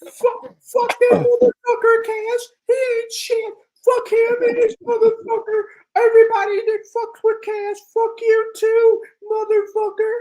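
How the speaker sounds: mocking impression. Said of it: "You sound like the character from Shark Tales".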